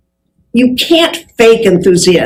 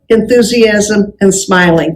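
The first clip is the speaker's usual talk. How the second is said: ordinary talk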